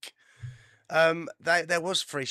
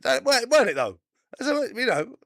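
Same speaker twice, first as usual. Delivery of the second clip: mock indignant; 10 semitones higher, 7 dB louder than usual. Mocking Iran's reply.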